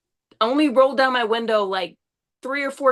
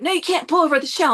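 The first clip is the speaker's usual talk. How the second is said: fast, higher-pitched protest; retold urgent objection; 5 semitones higher, faster than usual. Passenger objecting to the driver's plan, retold fast protest. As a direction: re-enact a hurried argument. Retold quarrel over where to stop.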